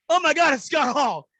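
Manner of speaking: high, loud, slowed gasp of recognition; the cop suddenly realizes who it is; starstruck surprise. Retold or imitated.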